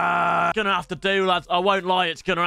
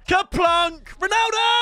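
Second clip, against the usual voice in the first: excited shout; 10 semitones higher, slower than usual. Real, not acted. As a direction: celebrate the big pull. Pulling Ronaldo from pack.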